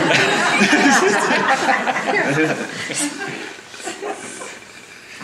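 long, loud, high laughter; real laughter, rising. Real, not acted. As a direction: let the laugh roll on.

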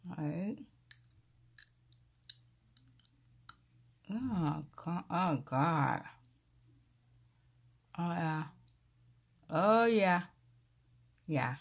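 talking while pushing in aligners; muffled speech, fingers on teeth; fingers in mouth. Real, not acted. Seen 0: Fingers in mouth pressing aligners; hands drop mid-clip, return later.

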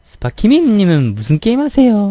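put-on high, loud Busan-accented question; a Busan player asking cheerfully; Busan gamer. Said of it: "부산이나 그쪽 거기에서 거주하는 분이". (높고 큰 부산 억양 질문)

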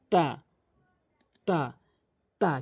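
তা with the tongue tip on the upper tooth roots; তা said as a teacher's example; Arabic letter ta. Shown as a demonstration. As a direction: model তা crisply for copying. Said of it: "তা, এভাবে উচ্চারণ করবেন".

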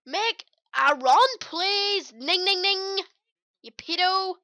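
high, loud shouting at the players; exasperated yelling. Real, not acted. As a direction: yell at your teammate to run, losing patience. Screaming at players in match.

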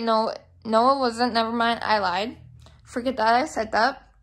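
ordinary delivery

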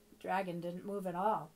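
usual speaking voice

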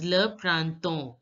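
le printemps as "luh pran-ton"; silent final letters. Shown as a demonstration. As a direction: drop the p and s at the end of printemps. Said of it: "P and S are not going to be pronounced".

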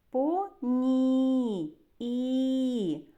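"По, ні, і" with a long, held I; "По, ні, і" split into syllables, last vowel sustained; drawn-out vowel. Shown as a demonstration. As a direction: stretch the final і of "По, ні" on a steady note. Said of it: "Ми можемо проспівати цей звук".

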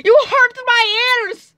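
high, slow fake-crying whine; mock wounded complaint. Complaining that sister hurt the ears, mock wounded whine. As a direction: drag it out like a hurt child.

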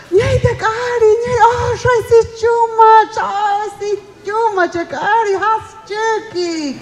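put-on high, slow, excited cooing over a baby; cooing elder. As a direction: coo at the newborn like a delighted elder.

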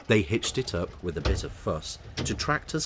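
ordinary delivery

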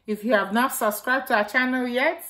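ordinary delivery

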